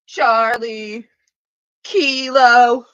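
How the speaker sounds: called out loud, almost a shout; hollering. Real, not acted. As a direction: holler the letters across the room. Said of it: "I'm hollering again".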